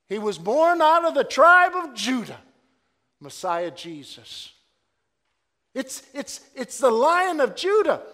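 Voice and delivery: loud, high preaching build; full-force preaching. Real, not acted. Preacher calling out to the congregation, loud and high. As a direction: cry it out from the pulpit.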